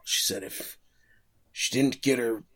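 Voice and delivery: lightly voiced character, faltering; put-on voice. Prosecutor recounts his lover's threat, lightly put-on voice. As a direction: imitate the confessing prosecutor, losing hold of the voice. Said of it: "I'm done doing the voice".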